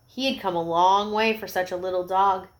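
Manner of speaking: plain reading voice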